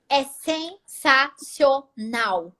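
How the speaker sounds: "sensacional" stretched out syllable by syllable; emphatic praise. Real, not acted. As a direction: break "sensacional" into slow, separate syllables. Emphatic praise of being free.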